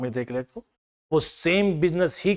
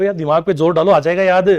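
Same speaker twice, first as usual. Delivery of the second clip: louder coaxing; 2 semitones higher, 7 dB louder than usual. Real, not acted. Teacher pushing class to recall.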